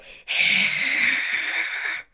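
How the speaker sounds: long loud growl. Shown as a demonstration. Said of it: "I'm more go like".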